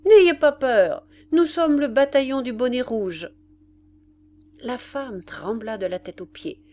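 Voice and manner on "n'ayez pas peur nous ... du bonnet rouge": loud, higher military call; sergeant's shout. A narrator's reading. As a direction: shout it out to the frightened woman. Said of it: "Le sergent lui cria". (cri de sergent)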